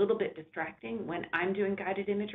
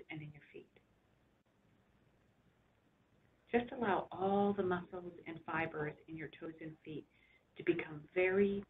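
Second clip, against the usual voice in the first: unhurried relaxation voice with long pauses; guided meditation. Real, not acted. Doctor guiding listeners to rest back and relax, soothing guided imagery.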